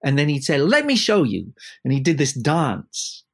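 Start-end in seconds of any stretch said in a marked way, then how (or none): 0.6-1.5 high, loud theatrical offer; showy camp maestro stepping in; camp theatrical conductor. Retold or imitated. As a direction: conductor about to dance for the players, high and camp. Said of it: "a very theatrical voice".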